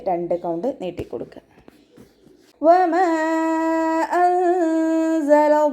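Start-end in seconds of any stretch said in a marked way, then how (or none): 2.6-5.7 slow, high melodic tajweed chant; chanted Quranic verse, drawn out; Quran recitation. Shown as a demonstration. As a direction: stretch each syllable in a ringing recitation.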